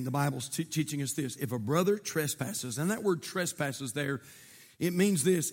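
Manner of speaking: ordinary talk, nothing marked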